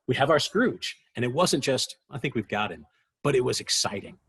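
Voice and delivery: normal talking voice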